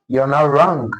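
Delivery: ordinary talk, nothing marked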